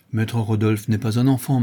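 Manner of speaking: ordinary delivery, nothing marked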